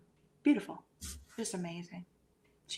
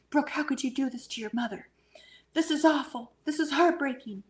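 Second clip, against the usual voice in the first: higher, louder mock scolding of a daughter; imitating upset yelling; 6 semitones higher, 5 dB louder than usual. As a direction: play a mother yelling in heartbreak. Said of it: "she's in the shower yelling at her".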